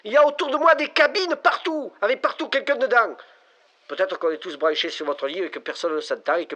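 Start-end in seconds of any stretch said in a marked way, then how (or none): 0.0-3.2 high, loud and frantic; panicked. Acted. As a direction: blurt it out in rising panic. Caller is panicking, going mad.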